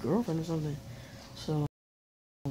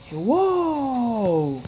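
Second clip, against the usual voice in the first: loud, higher cry of shock; real alarm as the screen goes dead; startled shout; 8 semitones higher, 7 dB louder than usual. Real, not acted. Screen suddenly dies mid-story.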